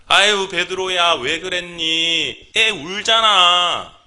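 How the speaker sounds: high, loud, drawn-out coaxing reproach; gently chiding Jesus. Acted. Jesus chiding Peter over a crying child, high drawn-out reproach. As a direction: chide a dear follower in long, singsong vowels. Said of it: "아마 이렇게".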